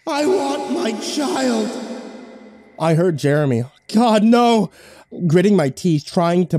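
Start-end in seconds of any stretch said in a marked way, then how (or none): 0.1-1.7 high, slow eerie wail; wailing witch. Acted. Drowned witch wails for child. 3.9-4.7 high, loud panicked cry; terrified cry. Acted. Jeremy cries out in terror.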